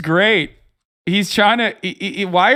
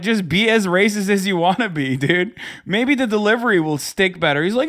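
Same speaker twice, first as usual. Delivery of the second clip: ordinary talk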